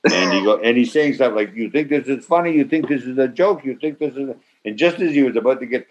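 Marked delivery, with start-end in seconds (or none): 1.5-4.4 mock angry director. Director repeats rage, approaching.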